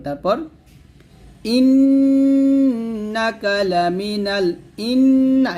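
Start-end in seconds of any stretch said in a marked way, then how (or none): 1.6-4.5 ইন্নাকা with held nasal ন্ন, slow; nasal ghunnah. Shown as a demonstration. Teacher demonstrating ghunnah on ইন্নাকা. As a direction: hum the নুন through the nose. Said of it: "এখন ওয়াজিব গুণা করবেন".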